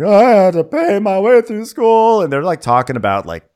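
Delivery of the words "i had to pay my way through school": high, loud, slow haughty voice; mock Jen. Housewife boasting to the others, high mocking voice. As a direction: say it like a proud rich housewife.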